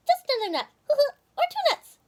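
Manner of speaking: high, squeaky coaxing chipmunk. Acted. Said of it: "said the chipmunk".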